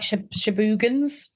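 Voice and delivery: Shibugans with "boo" in the middle; Capaldi's pronunciation. Retold or imitated. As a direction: say Shibugans slowly as shi-BOO-guns. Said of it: "Capaldi said it like".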